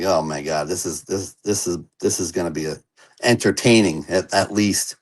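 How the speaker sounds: low, hushed thought to oneself; quiet inner aside. Retold or imitated. Newcomer watching the Italian guy get up, quiet dread-tinged aside. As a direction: drop right down, as if thinking it privately. His silent reaction as man stands up.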